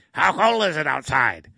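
Red Green's gravelly voice; gruff, loud question. Retold or imitated. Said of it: "in that gravelly voice would say".

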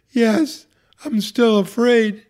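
a touch of a breathless, tearful yes; high, slow, fearful reply. Retold or imitated. Patient nodding to the priest, breathless and high. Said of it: "breathlessly say".

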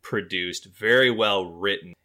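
usual speaking voice

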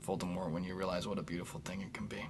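fast whispered aside. Real, not acted. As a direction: whisper it quickly to Voldemort.

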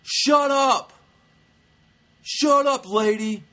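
high, loud mock crowd yell; imitated angry shouting. Angry audience fighting back at the heckler, shouted imitation. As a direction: yell it like a fed-up audience member. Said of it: "People start getting angry".

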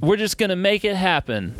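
high mocking voice; entitled concertgoer. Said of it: "you ever meet these people like".

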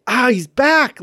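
high, loud burst of mock-viewer excitement; thrilled reaction. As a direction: exclaim it like a delighted fan.

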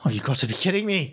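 annoyed, through clenched teeth; gritted teeth. Acted. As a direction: bite it out through your teeth. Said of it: "gritting his teeth".

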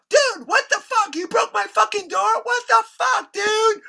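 very high-pitched furious yelling, retold; outraged yelling. Kirk finding his glass door broken, outraged yelling. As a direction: imitate a livid homeowner screaming after culprits.

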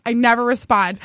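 ordinary talk

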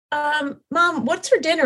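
lightly voiced child checking with mom; child asking mom. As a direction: play the kid, casual and hungry. Voicing her child asking mom.

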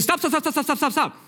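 high, rapid-fire repeated stops; sudden urgent halt. Acted. Jesus suddenly halting the truck, rapid high stammered order. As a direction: fire the word off over and over, high.

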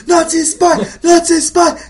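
high, loud mob chant; mock screamed accusation; screaming villagers. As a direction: shriek it over and over, hammed up.

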